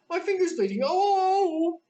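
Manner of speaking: hammed-up high wail; wailing in pain. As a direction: howl about the finger.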